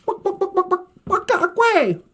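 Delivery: high chicken clucks bursting into a squawk; clucking chicken. Acted. Storyteller imitates the chicken in the coop, high clucking. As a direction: cluck fast like a hen. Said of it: "he can hear Baka Akwe, the chicken".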